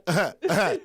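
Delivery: short mock sobs breaking through; two hammed-up crying grunts; imitated man cry. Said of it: "every now and then it'll be like".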